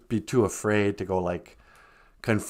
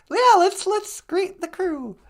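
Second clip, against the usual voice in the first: excited. Real, not acted. Greeting the crew.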